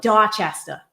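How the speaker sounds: Dorchester with dropped r, Boston style "Dotchesta"; thick Boston accent. Shown as a demonstration. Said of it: "really wanna hear the Boston accent".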